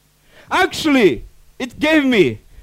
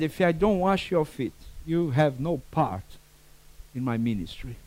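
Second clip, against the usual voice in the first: gentle Jesus; 10 semitones lower, 12 dB quieter than usual. Acted. Jesus answers Peter gently.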